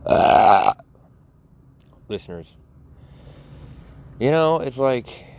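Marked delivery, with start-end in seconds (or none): none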